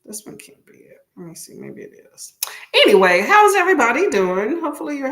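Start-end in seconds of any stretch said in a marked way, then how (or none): 0.0-2.3 near-whispered aside, half under her breath; muttering to herself. Real, not acted. Streamer searching for the sprint link, whispered mutter. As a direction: mumble it to yourself while hunting for a link.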